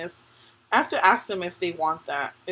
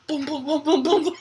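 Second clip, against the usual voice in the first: higher rapid booms; imitated crashing; 7 semitones higher, 4 dB louder than usual. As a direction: mimic things toppling in a row. Imitates things dropping in the aisle.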